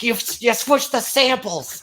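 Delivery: high, loud, drawn-out movie accusation; Fugitive movie outburst. Retold or imitated. Retelling a comic's Fugitive bit, mock dramatic outburst. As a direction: mimic a dramatic film confrontation at high pitch. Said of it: "the scene from The Fugitive".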